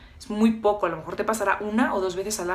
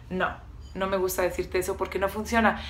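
ordinary talk

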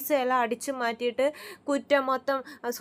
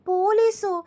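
higher and louder, stunned; shocked; 7 semitones higher, 5 dB louder, slower than usual. Retold or imitated. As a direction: gasp out the question in shock. Shocked reply to police news. (ഞെട്ടലോടെ ഉറക്കെ)

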